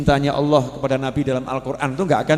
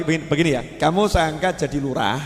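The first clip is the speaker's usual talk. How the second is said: usual speaking voice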